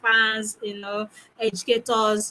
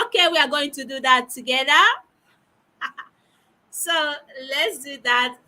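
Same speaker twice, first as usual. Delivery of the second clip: usual speaking voice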